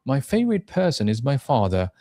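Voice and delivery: FAVORITE, PERSON and FATHER stressed; stress on content words. Shown as a demonstration. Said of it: "এই যে ওয়ার্ডগুলোতে জোর দেয়া".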